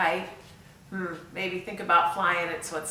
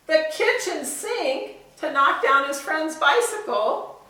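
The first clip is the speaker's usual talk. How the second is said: climbing higher with each item; building excitement; 6 semitones higher, 4 dB louder than usual. Acted. Teacher reading the growing list to kids, building excitement. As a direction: pile it on, rising each time.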